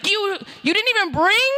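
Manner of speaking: hammed-up indignant yell about the charger; imitating her yelling. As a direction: play the outraged friend in the wheelchair. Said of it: "she was yelling at me".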